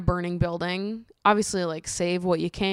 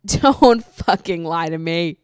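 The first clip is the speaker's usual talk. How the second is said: louder, slow, half-laughing warning; mock stern; 7 dB louder, slower than usual. Real, not acted. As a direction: drag it out like a playful scolding. Scolding internet toughies.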